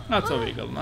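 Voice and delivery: normal talking voice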